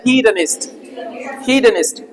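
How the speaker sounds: हीदनिस्ट with a short middle vowel, not "do"; "da-nist", not "donist". Shown as a demonstration. Teacher correcting "donist" for students, हीदनिस्ट with short middle vowel. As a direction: say हीदनिस्ट twice as "hee-da-nist". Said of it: "डोनिस्ट नहीं पढ़ा जाए".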